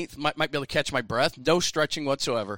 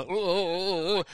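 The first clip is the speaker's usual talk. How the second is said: string of higher-pitched mock oohs; hobbling pained oohs; 5 semitones higher, slower than usual. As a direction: hobble along with hurt little hoots. Mimes running on one leg, hurt.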